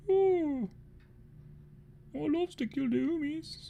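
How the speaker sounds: very high orc voice, drawn out; a silly little orc who loves a fight; gleeful orc. Pretend. Orc agreeing to go kill Hoomies: very high, gleeful.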